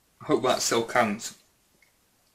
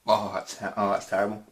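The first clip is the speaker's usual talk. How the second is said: words mangled by a mouthful of coffee; retching disgust through a full mouth. Real, not acted. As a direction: speak through gritty coffee grounds.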